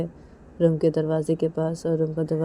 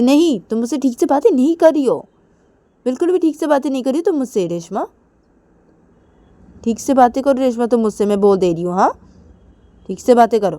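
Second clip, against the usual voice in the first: higher, louder stern insistence; higher loud scolding; scolding Kajal; 7 semitones higher, 9 dB louder, faster than usual. Acted. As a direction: warn her to behave.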